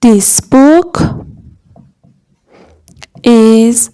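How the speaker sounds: word by word with long gaps; measured classroom dictation; slow dictation. Shown as a demonstration. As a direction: spell it out word by word for learners. Teacher dictating a translation.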